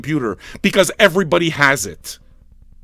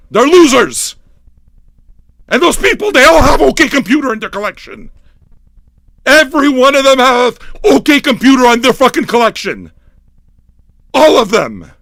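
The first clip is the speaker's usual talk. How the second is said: high, loud, ranting outburst; yelling rant; 7 semitones higher, 6 dB louder than usual. Real, not acted. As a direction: yell each accusation with full force. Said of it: "I love yelling".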